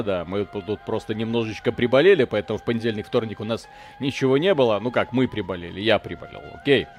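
usual speaking voice

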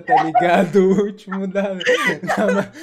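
laughing. Real, not acted. Laughs while joking about bus.

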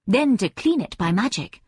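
ordinary delivery, nothing marked

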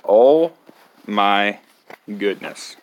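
plain reading voice

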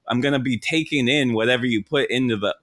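usual speaking voice

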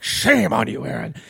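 indignant put-on reprimand; pissed-off uncle. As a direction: imitate an outraged relative shaming his nephew. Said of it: "he was just so fucking pissed".

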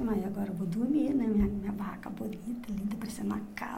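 hushed whisper, almost no voice. Retold or imitated. Mother whispers about her lovely tent at bedtime. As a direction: whisper it so the children keep sleeping.

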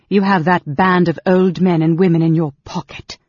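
loud, scornful retort; indignant, forceful charge; angry accusation. A narrator's reading. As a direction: accuse him loudly, with contempt. Angry queen accusing the scheming senator.

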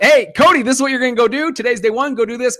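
rally him like a fired-up mentor on day one; pumped-up mentor. Retold or imitated.

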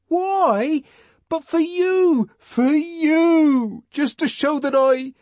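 put-on high, earnest young man's voice, pleading; John the Savage. John pleading with Lenina, high and fervent. John's voice in story.

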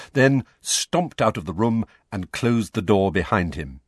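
ordinary delivery, nothing marked

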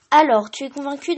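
triumphant pencil voice. A narrator's reading. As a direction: crow over your win. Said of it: "d'une voix triomphale".

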